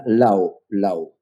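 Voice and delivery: laag uitgesproken low; low intonation demo. Shown as a demonstration. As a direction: laat de stem zakken op low. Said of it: "with high and s".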